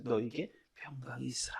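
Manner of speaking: 작고 소리 없는 속삭임; 아주 조용히 숨으로만 말하세요. Acted. 예수님 말씀을 다시 속삭임. (제자들에게 평강을 전하는 예수님, 작은 속삭임)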